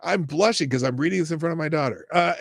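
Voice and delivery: normal talking voice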